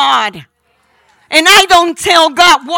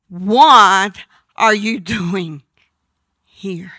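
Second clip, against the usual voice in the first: voice of God; 6 semitones lower, much slower than usual. Acted. Preacher voicing God asking Elijah in the cave, slow and lower. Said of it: "a voice came to him and said".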